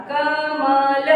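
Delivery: कमल stretched slowly, sound by sound. Shown as a demonstration. Teacher showing how to read कमल, slowly sounded out. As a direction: hold each syllable of कमल long. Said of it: "अब इसको हम पढ़ेंगे कैसे?".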